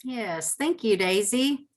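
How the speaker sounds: normal talking voice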